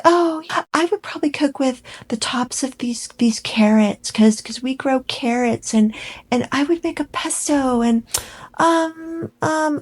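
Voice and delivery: high, hesitant mock-dainty voice; mocking Megan. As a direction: dither breathily like she's stalling. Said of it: "she was like".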